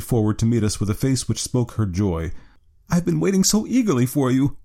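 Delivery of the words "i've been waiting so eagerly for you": put-on high, joyful young client's voice; eager cry. A narrator's reading. Client welcoming the detectives warmly, high eager cry. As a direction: greet with eager relief, pitched well up. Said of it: "she cried".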